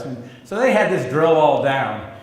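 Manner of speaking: ordinary talk, nothing marked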